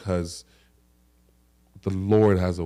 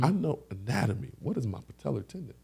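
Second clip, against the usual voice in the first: higher, softer incredulous puzzlement; baffled questioning; 5 semitones higher, 6 dB quieter than usual. Retold or imitated. Patient questioning the doctor's diagnosis, baffled. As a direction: query it as if hearing foreign words.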